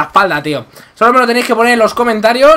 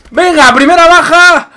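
excited shout; 8 semitones higher, 6 dB louder than usual. Real, not acted. Kill celebration shout.

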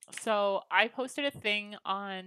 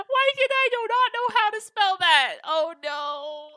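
much higher, loud flustered squeal; sudden embarrassed panic over spelling; flustered surprise; 10 semitones higher, 8 dB louder than usual. Real, not acted. Fails to spell a word.

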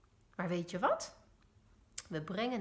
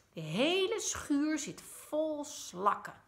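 higher, slow and cross; angry; 7 semitones higher, 3 dB louder, slower than usual. A narrator's reading. Mama tells papa the shed is full of snails, cross. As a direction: spell it out slowly, annoyed. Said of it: "zegt mama boos".

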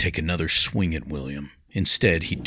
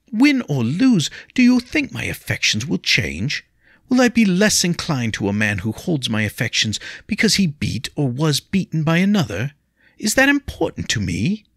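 high, louder anxious plea; pleading young lady; 10 semitones higher, 5 dB louder than usual. Acted. Lady begs William not to fight, high and pleading. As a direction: beg him to stop, voice raised high.

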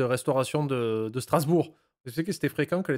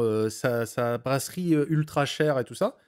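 usual speaking voice

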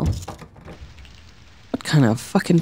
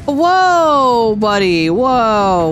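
sudden high startled whoa; 5 semitones higher, 3 dB louder, much slower than usual. Real, not acted. Player startled by a stranger in the subway, high surprised whoa. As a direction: jump back in surprise at a stranger.

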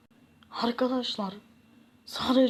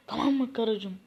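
normal talking voice

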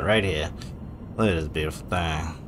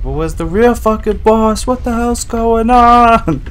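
high loud frustrated shout; exasperated yell; 14 semitones higher, 11 dB louder, slower than usual. Retold or imitated. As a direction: yell it out in disbelief. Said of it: "And I was like".

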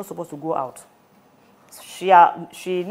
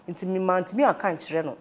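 usual speaking voice

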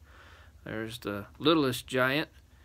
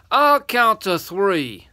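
slow, loud, raised warning; threatening giant; 8 semitones higher, 11 dB louder, slower than usual. Acted. Third giant warning the boy, threatening. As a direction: give a giant's ultimatum.

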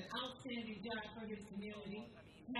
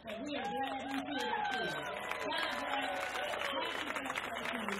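louder and louder, rallying the crowd; rousing cheer; 3 semitones higher, 7 dB louder than usual. Real, not acted. Councilwoman rallying the fans for the honoree's birthday, loud rousing cheer. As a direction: lift it up into a loud celebration. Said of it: "something to shout about, cheer about".

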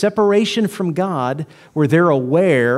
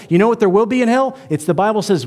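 normal talking voice

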